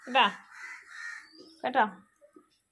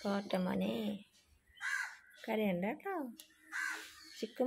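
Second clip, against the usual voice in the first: gentle lowered voice for a sad pet; soothing hush; 3 semitones lower, 7 dB quieter than usual. Real, not acted. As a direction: lower and softer, tender comfort.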